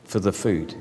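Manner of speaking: soft, low storytelling voice; reading a story to children, soft and low; story narration. Acted. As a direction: draw the children in quietly.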